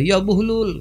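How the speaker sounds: high call. Retold or imitated. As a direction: call his name high. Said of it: "kata dia". (panggilan tinggi)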